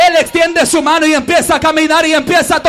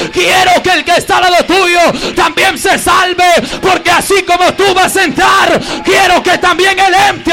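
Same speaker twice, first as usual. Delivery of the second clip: fervent preaching; 3 semitones higher, 4 dB louder than usual. Real, not acted. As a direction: build to a cry. Preaching builds to shout. (predicación a gritos)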